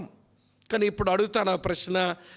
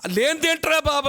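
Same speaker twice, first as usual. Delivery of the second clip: incredulous question; 7 semitones higher, 4 dB louder than usual. Retold or imitated. Mock question about Pharaoh's daughter.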